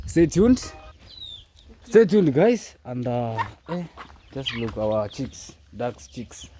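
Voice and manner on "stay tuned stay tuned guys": loud, high call to stay tuned; excited hype. Real, not acted. Vlogger hyping his audience for a surprise, loud and excited. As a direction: pump the viewers up.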